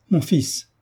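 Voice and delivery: cri nettement plus haut, bouleversé; cri de mère. Acted. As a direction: appelez votre fils, le cœur saisi. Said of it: "s'écria madame de Senneterre". (mère qui s'écrie vers son fils)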